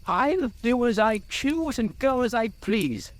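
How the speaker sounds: high-pitched, stubborn hobbit; obstinate. A narrator's reading. Bilbo defies Gandalf's advice, obstinate. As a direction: refuse to budge, high and insistent. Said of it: "said Bilbo obstinately".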